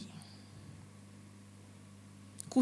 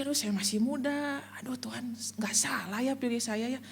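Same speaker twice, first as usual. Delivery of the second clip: whispered worry; 7 dB quieter than usual. Retold or imitated. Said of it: "Saya mikir".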